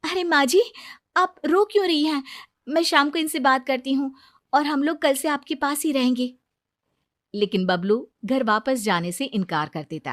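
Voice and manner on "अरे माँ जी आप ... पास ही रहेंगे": high, gentle reassurance; elder bride comforting her mother-in-law on the phone; caring daughter-in-law. Acted.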